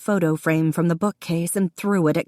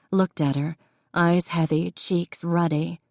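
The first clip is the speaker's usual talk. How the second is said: ordinary delivery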